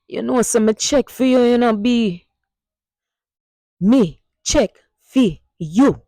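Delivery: casual Jamaican street talk; Jamaican Patois. Shown as a demonstration. Teacher demonstrating everyday Jamaican flirting to the class. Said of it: "you will hear Jamaicans use".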